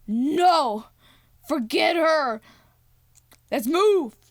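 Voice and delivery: retold forceful urging; acted firm command. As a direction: shout each order separately. Voicing Grace giving orders.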